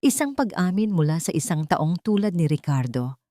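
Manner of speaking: ordinary delivery, nothing marked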